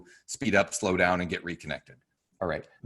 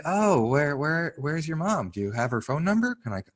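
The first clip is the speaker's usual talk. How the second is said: put-on gentle with a kid; 6 semitones higher than usual. Shown as a demonstration. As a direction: soothe a lost five-year-old. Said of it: "You'd say".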